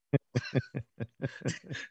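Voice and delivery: low, quiet chuckle; laugh. Real, not acted. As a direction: chuckle softly at your own joke. Laughs at own mock example.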